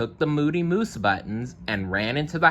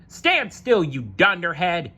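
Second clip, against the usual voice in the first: yelling; 9 semitones higher than usual. Acted. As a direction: yell at him to stop running. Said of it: "he yelled".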